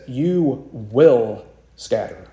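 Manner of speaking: slow, deliberate, each word weighted; emphatic Jesus. Acted. Jesus firmly telling Peter he will scatter, slow and weighted. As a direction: lay down the verdict. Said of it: "Jesus is emphatic".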